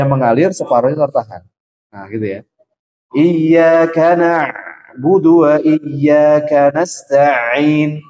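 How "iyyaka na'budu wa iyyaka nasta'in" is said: slow, drawn-out tajwid recitation; Quran recitation. Shown as a demonstration. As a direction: chant the verse slowly, as in prayer. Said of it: "ustadz recites Quran verse". (bacaan tartil yang pelan)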